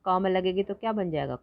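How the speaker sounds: ordinary talk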